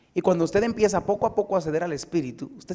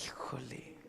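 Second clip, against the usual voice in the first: a breathless whispered híjole; whispered híjole, caught out; whispered gasp; 3 semitones lower, 17 dB quieter, slower than usual. Real, not acted. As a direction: whisper it in dismay. Caught off guard by question.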